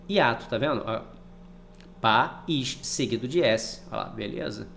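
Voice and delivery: ordinary delivery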